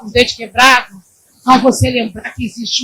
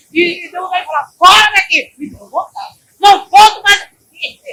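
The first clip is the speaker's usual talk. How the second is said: mock shout; 7 semitones higher, 4 dB louder than usual. Encena discussão com vizinha.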